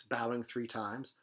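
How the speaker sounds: plain reading voice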